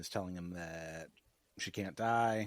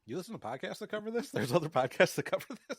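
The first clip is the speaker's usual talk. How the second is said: high-pitched, playfully incredulous questions; mock astonishment; 8 semitones higher, 4 dB louder than usual. Podcast host teasing co-host about other shows, mock astonished. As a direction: act shocked, voice climbing high. Jokingly amazed at rival podcasts.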